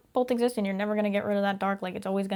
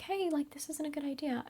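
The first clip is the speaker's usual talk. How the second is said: a soft, higher little nudge of caution; quiet warning; 6 semitones higher, 7 dB quieter than usual. Retold or imitated. Calm inner fear gently warning her, quiet. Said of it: "it's usually a little more quiet".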